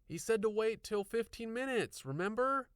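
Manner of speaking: put-on high girl's voice, pointed reminder; arguing. A narrator's reading. Girl arguing with her friend to wait, arguing voice. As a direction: argue back, pressing the point. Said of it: "she argued".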